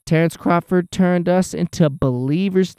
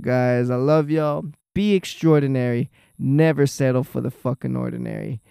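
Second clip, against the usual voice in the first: ordinary talk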